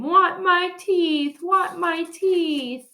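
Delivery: high, slow wailing demand; toddler whine. A narrator's reading. Fudge demanding his lost teeth back, wailing toddler. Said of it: "Fudge started to scream".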